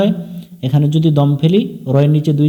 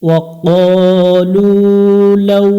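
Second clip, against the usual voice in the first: melodic Quran recitation; 3 semitones higher, much slower than usual. Shown as a demonstration. Teacher chanting verses slowly for students to follow. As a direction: stretch every vowel in a lilting recitation tune.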